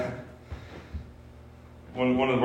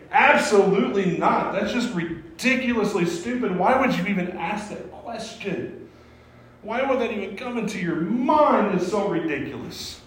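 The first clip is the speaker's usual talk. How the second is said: higher, exasperated dismissal; incredulous; 7 semitones higher than usual. Acted. Preacher mocking the question as absurd, exasperated. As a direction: scoff at a stupid question.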